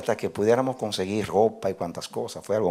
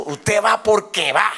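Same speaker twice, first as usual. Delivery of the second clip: slow, high, emphatic order; imposing mother; 11 semitones higher, 4 dB louder, slower than usual. Retold or imitated. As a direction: imitate mom laying down the law word by word. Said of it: "Y me impuso".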